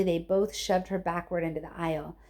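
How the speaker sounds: usual speaking voice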